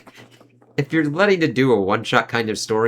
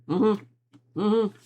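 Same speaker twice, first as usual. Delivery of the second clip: ordinary talk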